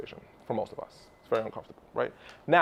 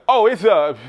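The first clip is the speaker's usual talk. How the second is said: louder, higher flustered stammer; sheepish fumble; 6 semitones higher, 9 dB louder, slower than usual. Retold or imitated. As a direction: lose your nerve mid-answer. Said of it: "from this lion to this sheep".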